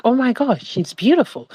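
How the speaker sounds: ordinary talk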